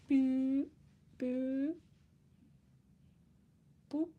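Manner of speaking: high sing-song boops. Pretend. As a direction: chirp them like an alert. Vocalized notification sound.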